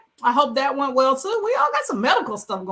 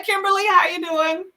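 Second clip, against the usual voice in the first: ordinary talk, nothing marked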